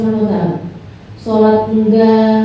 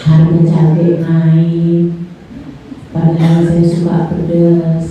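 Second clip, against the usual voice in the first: low, slow, drawn-out rambling; low slow dreamy talker rambling about chili prices; rambling congregant; 9 semitones lower, slower than usual. Acted. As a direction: lose the thread, list things lazily and deep. Rambling congregant talks nonsense.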